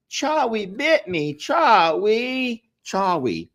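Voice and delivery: high, slow imitation of a little British kid. Quoting the viral video to listeners, imitated little British kid. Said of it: "It's like British, little British kids".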